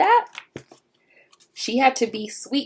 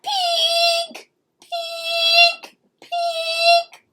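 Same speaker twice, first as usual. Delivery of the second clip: very high squeaking peek; squeaky alarm; 4 dB louder, much slower than usual. Acted. Pinkalicious's alarm goes off, very high squeaks. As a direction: chirp it like a toy alarm clock. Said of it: "went my alarm".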